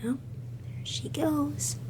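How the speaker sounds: hushed aside, barely voiced; whispered aside. Real, not acted. Teacher whispering to the class as Jean charges. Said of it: "teacher aside while reading".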